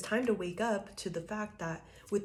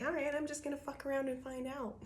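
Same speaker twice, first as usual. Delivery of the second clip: high, airy voice of a carefree queen; tossing it off, not a care in the world; playful higher voice of the unbothered woman on the card; carefree tarot figure; 8 semitones higher, 3 dB quieter than usual. Retold or imitated. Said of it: "She's like".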